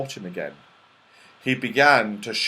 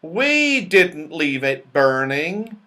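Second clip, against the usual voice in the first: much higher, louder and slow, worried; a fretful round-faced fellow asking who did it; anxious Moonface; 5 semitones higher, 4 dB louder, slower than usual. A narrator's reading. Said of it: "his big round face looking anxious".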